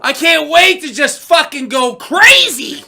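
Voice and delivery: high, loud, drawn-out yell; overexcited fan hollering; wild excitement. Retold or imitated. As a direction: shout it as if going crazy.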